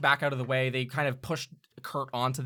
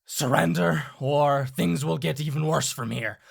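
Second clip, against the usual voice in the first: a touch louder, a gruff warning from the villain; stern villain voice; 3 semitones higher, 5 dB louder than usual. Acted. Villain warns the cowering man to surrender, stern. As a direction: lean in and threaten him, firm and steady. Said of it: "and he'll say".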